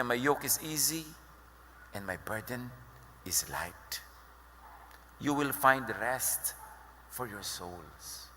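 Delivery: soft and slow, fading into a whisper; tender, fading. Retold or imitated. Jesus inviting the weary, fading to a whisper.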